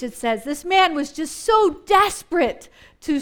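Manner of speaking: ordinary talk, nothing marked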